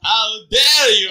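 slow, loud indignant demand; a hammed-up stern professor. A narrator's reading.

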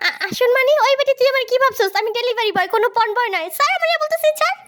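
very high-pitched frantic indignation; outraged protest. Acted. Delivery man being dragged by customer, outraged. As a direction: object in rising panic and outrage. Fights off customer pulling him.

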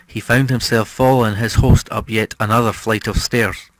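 ordinary delivery

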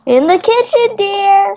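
high, sweet housewife voice. Pretend. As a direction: answer like a doting wife. Plays a wife answering her husband.

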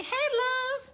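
high, sing-out pet-name greeting; put-on sugary high voice; mock sweet-talker. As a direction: imitate someone gushing a term of endearment. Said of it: "I don't say".

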